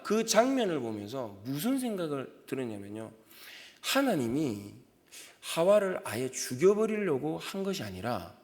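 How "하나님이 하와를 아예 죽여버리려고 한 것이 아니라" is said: normal talking voice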